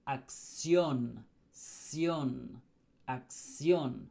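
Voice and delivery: "acción" as ak-SYON, punching the last syllable; stress on final ción. Shown as a demonstration. Teacher shows where the accent mark falls, final stress on "acción". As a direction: land firmly on "ción", falling, each time in "acción". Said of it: "where the emphasis is".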